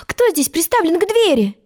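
put-on high, indignant girl's demand; angry question. Alice turns on whoever minds the door, angry high voice. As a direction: ask crossly, pitched well up. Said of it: "сердито спросила она".

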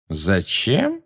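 questioning lift, drawn out in disbelief; incredulous amazement. A narrator's reading. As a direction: ask it slowly, as if you can't believe it. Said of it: "прозвучало недоверчивое изумление".

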